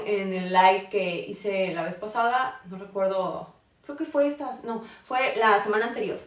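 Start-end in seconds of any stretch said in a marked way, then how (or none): none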